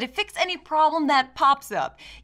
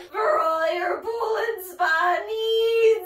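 ad jingle; 10 semitones higher, much slower than usual. Pretend. Mock sponsor jingle read.

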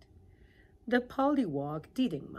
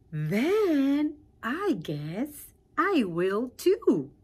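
happy pollywog; 6 semitones higher, 11 dB louder, much slower than usual. Acted. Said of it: "the pollywog said very happily".

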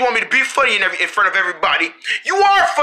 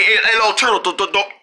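ordinary delivery

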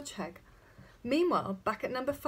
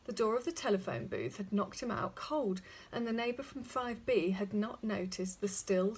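ordinary delivery, nothing marked

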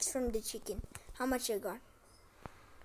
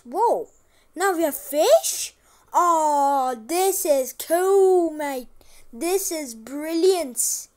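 loud, slow, delighted wow; thrilled by a new discovery; a gamer gasping with joy at fish; excited; 3 semitones higher, 9 dB louder, much slower than usual. Real, not acted.

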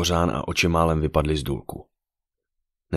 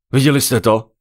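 loud, high alarmed yell; excited raised-voice shout; 7 semitones higher, 8 dB louder than usual. A narrator's reading. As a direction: yell the question to everyone. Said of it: "zařval Adrian".

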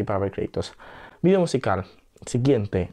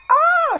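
shrill shocked scream; 7 dB louder than usual. Real, not acted. Screaming at video.

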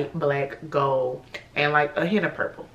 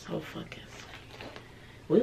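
slow awed whisper; slow unvoiced whisper of awe; 13 dB quieter, much slower than usual. Real, not acted. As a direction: breathe it out, moved.

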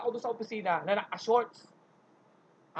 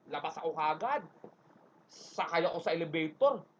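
normal talking voice

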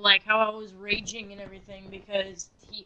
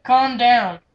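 firm and noticeably louder; loud mock command; 3 semitones higher, 8 dB louder than usual. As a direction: push it out like an exasperated order. Telling the raging friend off.

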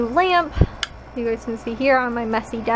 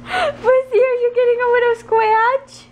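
higher, doting pet voice; 11 semitones higher, 5 dB louder than usual. Real, not acted. Owner cooing at her dog mid-scratch, in high doting baby talk. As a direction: coo at the dog.

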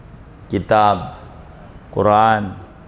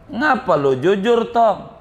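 lightly acted, higher-pitched incredulous question; 7 semitones higher than usual. Robber king to honest boy, incredulous. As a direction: ask as if you can't believe it, higher.

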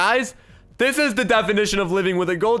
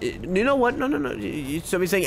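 ordinary talk

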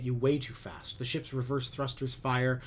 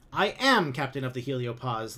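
louder stubborn insistence; 2 semitones higher, 9 dB louder than usual. A narrator's reading. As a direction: dig in and repeat it. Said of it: "Lum repeats stubbornly".

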